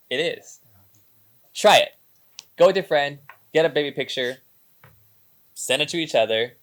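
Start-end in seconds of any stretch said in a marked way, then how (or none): 1.5-6.5 louder, higher call to try it; rally the listeners to act; loud urging. Real, not acted. Host urging viewers to do the exercise, loud and eager. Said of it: "I challenge you".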